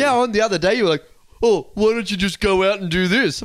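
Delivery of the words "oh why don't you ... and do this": higher, louder dopey caveman quote; mock a dimwit boyfriend; dumb Neanderthal voice. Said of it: "that kind of Neanderthal voice".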